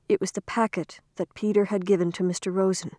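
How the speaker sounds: ordinary delivery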